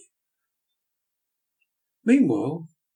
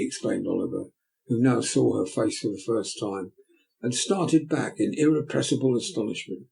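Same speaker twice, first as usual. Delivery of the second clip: ordinary delivery, nothing marked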